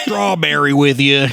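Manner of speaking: slow cowboy drawl impression; Arthur Morgan. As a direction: stretch it out like a reluctant cowboy. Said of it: "Arthur Morgan".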